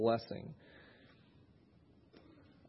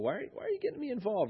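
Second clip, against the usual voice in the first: lightly teasing, higher and quicker; playful; 8 semitones higher, faster than usual. Acted. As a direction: playfully act put out at being asked. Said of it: "Jesus is just being kind of playful".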